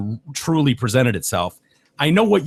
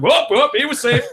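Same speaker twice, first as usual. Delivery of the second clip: high hasty backpedal; hammed-up scared ump; mock panicked umpire; 12 semitones higher than usual. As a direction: reverse the call in a hurry. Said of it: "the umps are like".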